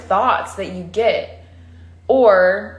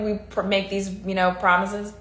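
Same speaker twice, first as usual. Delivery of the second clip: ordinary talk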